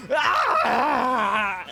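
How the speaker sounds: loud pained monster scream; scream in agony. Acted. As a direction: howl like you're being burned. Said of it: "the thing screams in agony".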